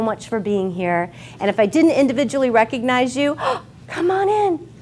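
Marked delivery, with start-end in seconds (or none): 1.7-4.7 fighting back tears while welcoming everyone; choking up. Real, not acted. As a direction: voice catching on tears, gasping. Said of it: "I'm so emotional".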